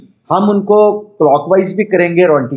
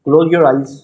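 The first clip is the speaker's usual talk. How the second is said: measured meditation voice with long pauses; guided meditation. Acted. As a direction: guide them inward, phrase by phrase. Leads a chakra meditation.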